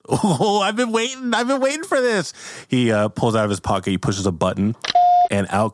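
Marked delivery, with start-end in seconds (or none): 0.1-2.3 put-on very high excited kid voice; gleeful boy who can't wait; eager kid. Timmy eagerly answering his dad, high and excited.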